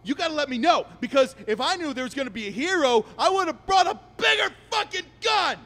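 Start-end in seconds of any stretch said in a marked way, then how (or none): 3.2-5.6 high-pitched, shouted threat; sarcastic rant. Acted. Robber mocks would-be hero, shouting. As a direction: yell it in mock regret.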